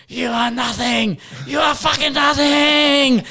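high, long-drawn mock yell; drunken yelling. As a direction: imitate a drunk hollering across the water. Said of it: "He just yelling out".

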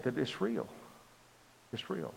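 awed hush. Real, not acted. Telling the congregation it's real, in an awed hush. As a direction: let it fall to a hush.

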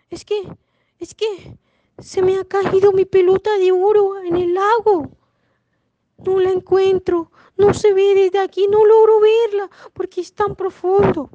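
high, loud, whining lament; hammed-up distressed plea, held high; tearful princess. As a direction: wail the explanation like an upset storybook princess. Crying princess explains her loss.

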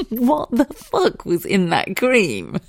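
high and slow, laughing through the words; cracking up at her own story; laughing disbelief. Real, not acted. Telling viewers about the glowing cream, laughing.

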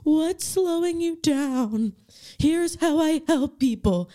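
slow, high, stilted script reading. Retold or imitated. Nervous introvert on a sales call, reading off script. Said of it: "I literally read off the script".

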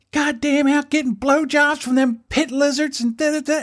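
high, put-on shrill-voiced ranting wife; imitating the screaming wife. Friend's wife scolding him over underwear, high hammed-up rant. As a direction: rattle it off as the furious wife.

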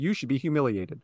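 low, soft mock scolding; a hushed mock reprimand. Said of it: "he said".

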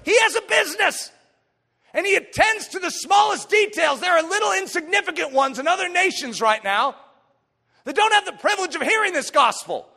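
high, shouted sermon delivery; loud, urgent pulpit cry; full-force preaching. Real, not acted. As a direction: drive each phrase home at full volume.

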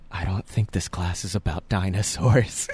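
low, hammed-up disappointment; mock-grave aside. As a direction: drop deep to deliver the letdown. Acted aside to friend in class.

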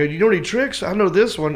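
high-pitched stage patter; cocky showmanship. Retold or imitated. Comic about to do a Zippo trick, showing off. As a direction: announce the trick with swagger.

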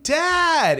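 put-on high, loud kid's shout; excited call. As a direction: run up calling "Dad!". Son calling out to dad.

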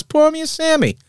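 very high put-on barfly voice; Ratzenberger. Actor ordering from his host at the office bar, high impression. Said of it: "He goes".

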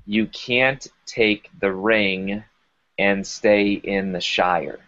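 slowly, each word given weight; you can't take the ring, spoken slowly like a solemn warning; slow, weighty quote. Retold or imitated. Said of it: "He says".

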